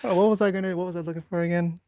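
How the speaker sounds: spacey voice asking himself what he was doing; mock confused trader. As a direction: play a lost, forgetful trader looking around. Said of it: "You're just looking around".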